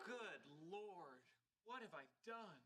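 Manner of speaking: soft, hushed dismay; quiet remorse. Acted. Sheldon realizing he upset Hawking, hushed.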